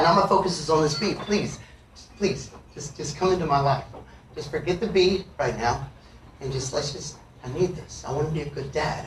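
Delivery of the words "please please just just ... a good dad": low, soft, halting prayer; quiet pleading. Retold or imitated. Would-be good dad praying past a bee, hushed pleading. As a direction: plead under the voice, trying to focus.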